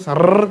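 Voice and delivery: सर with a long frog-like trilled r; croaking rolled r. Shown as a demonstration. Teacher mocking students who croak like frogs, rolled r. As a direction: roll the end of सर out long like a croak. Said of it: "Don't become मेंढक".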